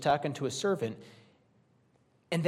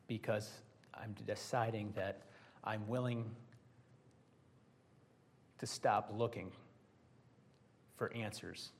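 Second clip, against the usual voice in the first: resigned and quiet; 5 semitones lower, 7 dB quieter than usual. Retold or imitated. Preacher voicing someone settling for what they have, quietly resigned. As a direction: let it sink into giving up.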